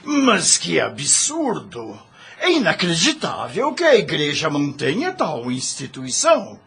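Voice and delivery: high, incredulous outburst; appalled disbelief, voice pitched high; shocked indignation. Acted. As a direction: react as if the news is outrageous. Indignant reaction to Church abuses.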